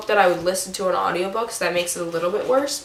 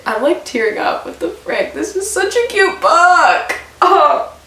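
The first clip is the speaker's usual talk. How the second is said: overwhelmed, welling up as you gush; real happy-tearful outburst; tearing up; 9 semitones higher, 4 dB louder than usual. Real, not acted. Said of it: "Tearing up talking about a book".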